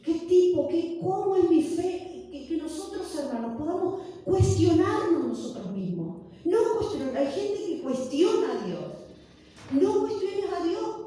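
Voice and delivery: loud, high preaching to the congregation. Acted. Pastor preaching to her congregation, loud and high. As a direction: exhort the brothers at full force.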